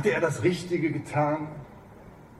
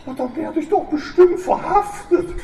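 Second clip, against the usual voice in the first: high, cracking, about to cry; voice breaking; 4 dB louder than usual. Acted. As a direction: let the voice crack with fear. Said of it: "sagte Frau Kamps mit brechender Stimme".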